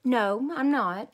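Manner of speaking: put-on slow, loud child's protest; stubborn denial. A narrator's reading. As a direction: drag out a stubborn no. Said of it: "I said".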